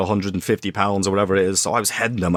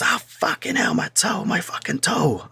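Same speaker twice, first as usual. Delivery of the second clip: high, strained mock Cockney yelp; badly faked Cockney howl of pain; Cockney accent; 9 semitones higher, slower than usual. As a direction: imitate a hurt Cockney, high and drawn out. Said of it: "I can't do a Cockney accent".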